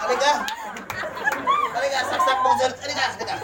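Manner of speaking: laughing, fast playful calling out; mock dare. Pastor teasing a child to touch the outlet, laughing mock dare.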